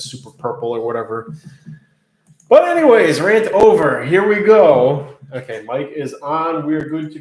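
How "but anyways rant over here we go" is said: loud. Real, not acted. Loud reset after wine rant.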